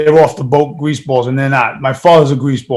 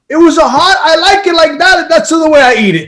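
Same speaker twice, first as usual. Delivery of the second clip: put-on high, loud Italian accent broken by laughter; the father's voice, pushed even further; Italian dad accent; 12 semitones higher, 4 dB louder than usual. Son impersonating his dad's Italian accent, laughing. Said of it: "you nailed the accent too".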